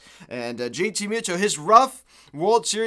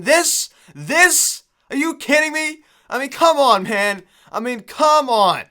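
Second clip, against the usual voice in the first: higher and louder, incredulous and furious; real exasperated outburst; 8 semitones higher, 7 dB louder, slower than usual. Real, not acted. Fan raging at his team getting no-hit, loud disbelief. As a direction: shout your disbelief at the team. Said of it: "I am irate right now".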